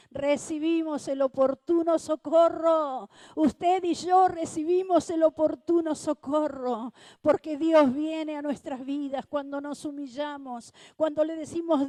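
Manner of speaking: loud, high, ringing sermon voice; fervent preaching. Acted. Preacher rousing the congregation, loud and fervent. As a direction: preach it to the congregation full force.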